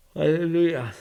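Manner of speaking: a loud yawn through the word. Real, not acted. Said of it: "My apologies for just yawning".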